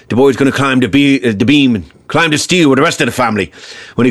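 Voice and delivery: put-on thick Irish brogue of an old grandfather; off-the-boat Irish patriarch; Paddy Flynn. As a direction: lay down the law in a heavy Irish accent. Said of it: "Paddy was really off the boat, and so his accent was a lot, you know, deeper".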